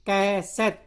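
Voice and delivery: "keset" with two open è vowels; "keset" as kè-sèt; correct open E. Shown as a demonstration. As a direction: say "keset" as keh-set, syllables apart. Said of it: "tulisannya dibaca".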